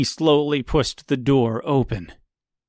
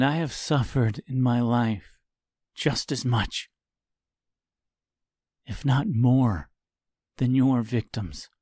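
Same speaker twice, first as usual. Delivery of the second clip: gentle and sincere. Acted. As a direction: talk him down gently. Tip consoles Reginald.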